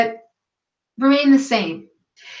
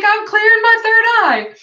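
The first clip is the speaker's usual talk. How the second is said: much higher and louder, bright mock innocence; chirpy retold reply; 11 semitones higher, 5 dB louder than usual. Telling husband why crystal is taped to forehead, high chirpy reply. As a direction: sing it out high like it's obvious. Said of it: "And I'm like".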